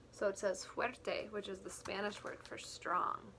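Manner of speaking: ordinary delivery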